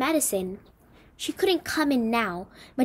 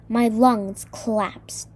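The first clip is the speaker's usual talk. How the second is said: ordinary delivery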